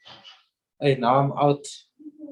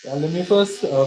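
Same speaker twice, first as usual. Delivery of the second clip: ordinary talk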